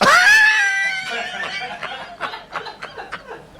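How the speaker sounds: high, loud shrieking laughter. Real, not acted. Podcast hosts reacting to a crude fan message, shrieking laugh. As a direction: scream with laughter.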